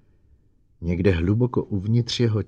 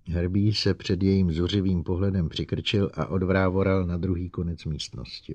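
plain reading voice

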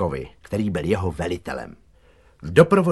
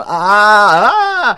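long, very high, loud yell; panicked "aaah" held at full voice; terrified roar; 12 semitones higher, 12 dB louder than usual. A narrator's reading. Gardener hanging in a tree above the dog, roaring. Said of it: "a řval".

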